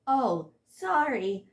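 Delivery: a bashful, drawn-out reply; shy, embarrassed. A narrator's reading. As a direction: apologize bashfully, drawing it out. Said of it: "replied in a shy, embarrassed way".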